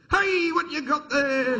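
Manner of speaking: high, slow, stretched-out nervous query; nervous dad. Retold or imitated. Dad spots son holding a vibrator, high nervous query. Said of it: "fucking dad when they're nervous".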